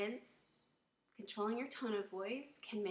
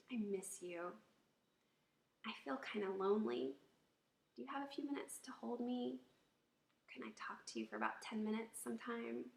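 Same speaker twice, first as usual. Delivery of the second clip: tender appeal; 2 semitones higher than usual. Shown as a demonstration. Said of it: "much better approach is gonna be".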